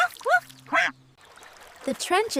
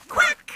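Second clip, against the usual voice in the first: a sharp quack, much higher; duck quack; 13 semitones higher, 4 dB louder than usual. Acted. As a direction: quack like an excited little duck.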